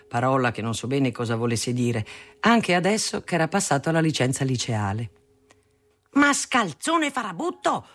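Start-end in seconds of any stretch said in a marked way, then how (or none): 6.1-7.9 slow, high-pitched angry yell; father yelling. A narrator's reading. Father to son coming home at night, angry yell. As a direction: yell the insults high and drawn out. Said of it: "urlava mio padre la notte".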